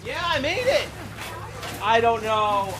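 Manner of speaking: very loud, high-pitched whoop of victory; real, breathless-with-excitement celebration at full volume; triumphant shout. Real, not acted. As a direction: yell in excitement at making it. Celebrates making the jump.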